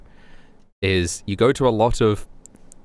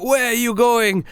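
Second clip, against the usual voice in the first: much higher, slow mock demand; mock accusing; 14 semitones higher than usual. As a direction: call after them, overacted. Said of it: "he's like".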